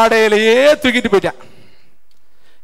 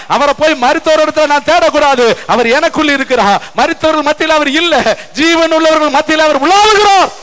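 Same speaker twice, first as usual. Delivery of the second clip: ordinary talk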